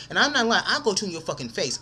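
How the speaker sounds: normal talking voice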